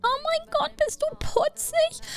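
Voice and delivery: very high, squeaky cooing; cutesy high voice. Acted. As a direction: coo at a cute little horse. Cooing at the horse.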